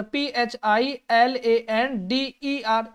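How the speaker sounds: letter by letter, slow and deliberate; spelled out slowly. Shown as a demonstration. Teacher spelling the word slowly for students. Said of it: "Flanders की spelling होती है".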